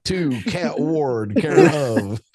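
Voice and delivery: ordinary talk, nothing marked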